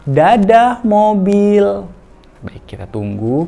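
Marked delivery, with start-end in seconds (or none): 0.0-1.9 high, loud, slow bye-bye to the car; sing-song goodbye to toy. Shown as a demonstration. Parent coaxing child to leave toy, high slow sing-song. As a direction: wave the toy car goodbye in baby talk. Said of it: "kita hitung satu sampai lima".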